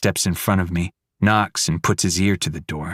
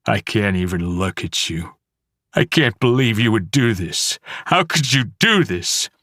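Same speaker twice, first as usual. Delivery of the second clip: tearful accusation. Acted. Dad crying, accusing.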